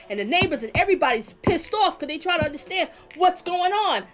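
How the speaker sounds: loud, high, indignant tirade; real worked-up fury; heated rant. Real, not acted. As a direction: vent the outrage full force. Heated rant about the missing boys.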